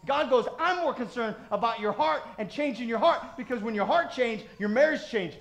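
loud, insistent pulpit voice; building sermon push; preaching. Acted. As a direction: press the point on the congregation.

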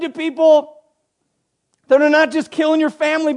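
high, forceful pulpit delivery; impassioned preaching. Real, not acted. Preacher voices Paul's charge to a persecuted church, preaching forcefully.

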